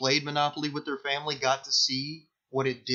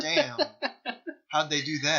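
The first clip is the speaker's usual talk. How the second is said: mock amazement; 3 semitones higher than usual. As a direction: fake wow, pitched up.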